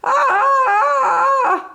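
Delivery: wild rushing scream. A narrator's reading. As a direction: scream as you fly. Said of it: "Lightning screamed".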